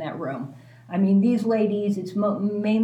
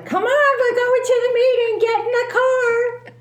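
high, loud bright summons, much higher; cheery call; 6 dB louder than usual. Retold or imitated. Neighbor at the door hauling her to the meeting, loud and high.

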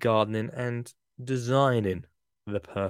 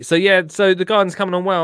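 loud restart; 8 semitones higher, 10 dB louder than usual. Real, not acted. Loud restart after ads.

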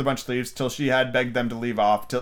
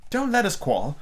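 higher, mildly anxious plea; 9 semitones higher than usual. A narrator's reading. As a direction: plead lightly for peace. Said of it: "anxious tone".

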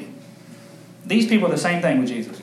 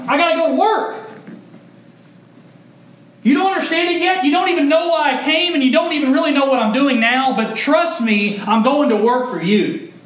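high, loud, urgent pleading explanation; Jesus urging his followers to trust him, high and loud; Jesus as departing dad; 11 semitones higher, 4 dB louder than usual. Acted. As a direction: plead with them like a dad heading out the door. Jesus voiced like a dad leaving.

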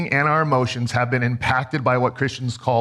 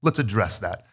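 softer and lower, gently reassuring a shaken daughter; tender; 6 semitones lower, 3 dB quieter than usual. Retold or imitated. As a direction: say it like a comforting dad, quietly. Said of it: "being tender with it".

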